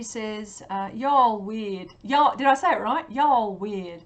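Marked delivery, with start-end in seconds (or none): 0.9-1.9 loud, drawn-out bad Southern US accent; attempted American accent. Vlogger reading a viewer's comment aloud, attempted American accent. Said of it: "don't do a very good American accent". 2.0-4.0 put-on loud, not-very-good Southern American accent; attempted Southern accent. Vlogger checking her pronunciation for a viewer, attempted Southern accent. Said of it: "or a, a Southern accent".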